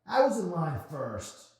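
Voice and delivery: high, loud, slow indignant bark; angry bark. Acted. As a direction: bark it like a pit bull. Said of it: "he barked".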